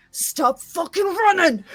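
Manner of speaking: high, loud, drawn-out shout; in-character battle yell. Acted. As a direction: yell after a fleeing foe. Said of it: "as I'm doing it".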